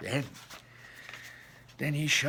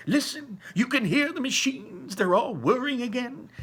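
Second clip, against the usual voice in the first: higher, eager cry to the crowd; excited calling out; 9 semitones higher, 4 dB louder than usual. Acted. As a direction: point out the noise with thrilled wonder. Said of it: "the people shouted".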